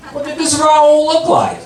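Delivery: incredulous echo, climbing high; suspicious questioning. Acted. Husband asking wife about the naked model, suspicious. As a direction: question it with rising, jealous suspicion.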